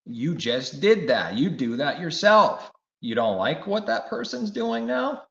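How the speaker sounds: loud, high accusing call; preaching rebuke. Acted. Preacher confronting the hypocrite in his congregation, loud accusing. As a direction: throw the hypocrisy back at them loudly.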